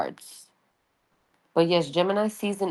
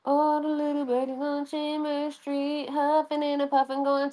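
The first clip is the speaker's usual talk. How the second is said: much higher, playful sing-song; high singsong voice; 10 semitones higher than usual. Pretend. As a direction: sing it like a chirpy nursery rhyme. Sings about birdies chirping.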